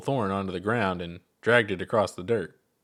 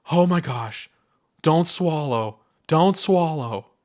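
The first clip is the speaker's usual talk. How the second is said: high, louder pleading, slowing at the end; begging herself not to swallow; panicked; 6 semitones higher, 5 dB louder, slower than usual. Acted. Chuck panicking with Ponder inside her mouth, high pleading.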